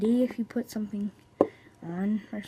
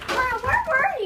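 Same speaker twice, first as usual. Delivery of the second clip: high mock greeting; 13 semitones higher, 10 dB louder, slower than usual.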